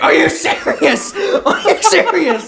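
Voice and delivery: very high, drawn-out squeal; slow, squeaky mock outrage; outraged disbelief. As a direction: wail it incredulously, hammed up. Retold disbelief as mom succeeds instantly.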